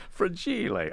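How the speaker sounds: Fragile said high and soft in mock Italian, fra-jee-lay.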